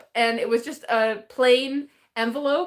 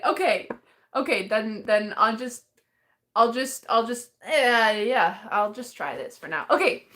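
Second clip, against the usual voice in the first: ordinary delivery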